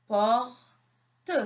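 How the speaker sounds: "Porte" as two separate beats, "por", pause, "te"; split into syllables. Shown as a demonstration. Teacher counts syllables with children, syllable by syllable. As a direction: break "Porte" apart, sounding the final "te" alone. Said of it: "comptez avec moi".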